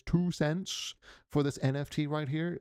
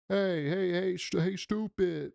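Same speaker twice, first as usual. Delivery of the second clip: higher-pitched mock heckle; mock calling out; 6 semitones higher than usual. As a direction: imitate an annoyed driver calling someone out. Acting driver calling out at pedestrian.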